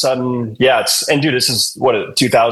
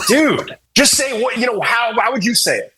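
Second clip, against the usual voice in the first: mock exasperation; 10 semitones higher than usual. Bandmate begging the actor to sound natural, mock exasperation. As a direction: coach him in a high, exasperated voice.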